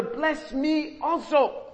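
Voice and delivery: high, slow anguished begging; drawn-out plea. Retold or imitated. Esau crying to his father, slow high plea. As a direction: stretch out the plea, desperate. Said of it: "cry to his father".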